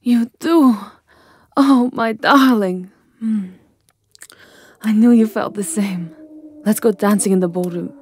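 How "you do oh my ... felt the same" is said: higher, louder delighted gasp; overjoyed at his answer. Acted. Delighted reply to Hunter.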